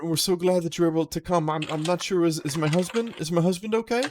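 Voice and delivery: shaken lady. Acted. Lady answering the watch, high and shaken. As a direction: stumble over the words, high and anxious. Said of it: "And she says".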